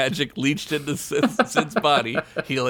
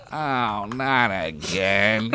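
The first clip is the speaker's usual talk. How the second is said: slow, low, tired groan; weary whine; 3 semitones lower, much slower than usual. Acted. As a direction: drag it out wearily. Weary fly man sighs.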